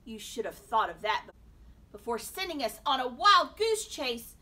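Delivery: loud, taunting rebuke; jeering. A narrator's reading. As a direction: rub it in with a jeer. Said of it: "Mister Natisse jeered".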